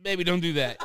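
loud, through laughter; laughing. Real, not acted. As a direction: say it while cracking up. Laughing while retelling.

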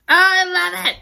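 high squeal of delight; delighted gushing. Real, not acted. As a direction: burst out adoring it. Gushing over cat scene.

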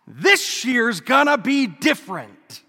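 loud, slow, emphatic vow; mock determined resolution. Priest imitates people on New Year's Day, loud mock determination. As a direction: declare it like a grand resolution.

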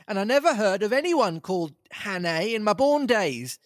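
high, furious tramp voice; flying into a rage. Acted. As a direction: rage then back down. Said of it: "I flew into a rage".

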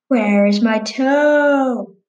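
slow, drawn-out moaning ghost voice; ghostly groan.

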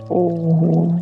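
ordinary talk

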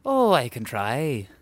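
slow, lilting attempt at an Irish accent. Shown as a demonstration. Agreeing to do the trailer, already in Irish accent. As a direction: answer in a put-on Irish lilt. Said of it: "can you do it in an Irish accent".

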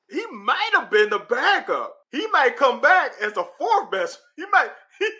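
high, laughing, worked up; excited laughing. Real, not acted. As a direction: tell it through laughter. Excited about Juton, laughing.

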